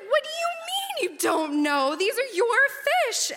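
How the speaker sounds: loud, very high incredulous yell; panicked outburst. Retold or imitated. Stung researcher rounds on the clueless colleague, panicked yelling. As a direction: shriek it in disbelief, stretching the last words.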